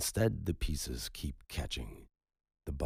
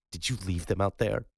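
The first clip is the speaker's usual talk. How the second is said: louder, higher accusation; accusing demand; 6 semitones higher, 6 dB louder than usual. Acted. The widow accuses Gus of abandoning the men, loud. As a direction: throw it at him like a charge.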